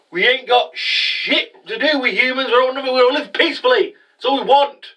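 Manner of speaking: high-pitched pleading retort, acted out; impassioned character protest. Voicing Ignis pleading to be left alone.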